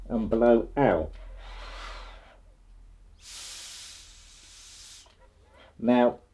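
muffled behind face mask; talking through a respirator. Real, not acted. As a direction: hand over mask. Seen 2: Grey half-face respirator covers nose and mouth; hand over it.

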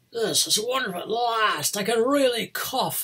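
high, loud, overjoyed mock ogre; hammed-up delight. Retold ogre with cured back, hammed-up delight. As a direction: crow it with exaggerated relief, stretching the last words.

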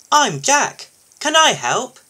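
high, loud, keen little loader; perky high character voice offering help; eager newcomer. A narrator's reading.

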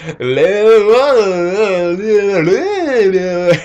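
high hammed-up cackle; witch cackle. Villain cackling at her humiliated victim, hammed-up. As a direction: let out a gleeful old witch's cackle. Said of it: "And she's like".

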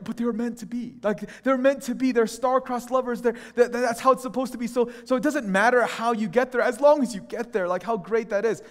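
lightly mock starry-eyed film fans; romantic defenders. Voices the film's defenders.

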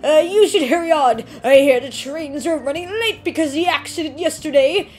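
put-on old man lamenting the news to the student. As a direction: ham up a worried old man. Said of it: "my old man voice".

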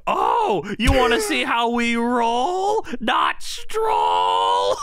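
mock furious shout at the heel; angry shout. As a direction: roar it like an outraged announcer. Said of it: "so angry".

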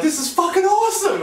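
very high, loud elated shout; thrilled. Retold or imitated. Floating through the clouds, an elated high shout. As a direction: burst with sudden joy. Panic turns to thrill mid-air.

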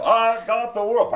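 loud, high, belted-out song line; gleeful bellowing. Acted. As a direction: holler along to the music while having a ball. Acting gunman bellowing along to song.

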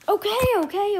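sudden high, loud panic; panicked. Real, not acted. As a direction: blurt it out in fright. Panicked by jump scare.

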